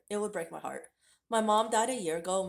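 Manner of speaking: usual speaking voice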